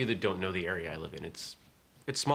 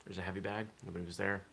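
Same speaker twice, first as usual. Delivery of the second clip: ordinary talk, nothing marked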